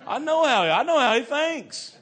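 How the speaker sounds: very loud, high-pitched holler; full-volume excited outburst; excited shouting. Real, not acted. Preacher exclaims over his brother.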